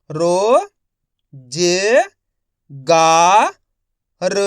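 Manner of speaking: रो, जे, गा, र each held, pauses between; syllable-by-syllable reading. Shown as a demonstration. Teacher modelling for learners, रो जे गा र one syllable at a time. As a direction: sound out रो... जे... गा... र separately. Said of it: "एक एक अक्षर का उच्चारण करके".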